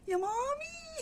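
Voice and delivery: high, slow, drawn-out gasp of mommy; gasping in awe. Real, not acted. Owner amazed at a kitten being born, gasping.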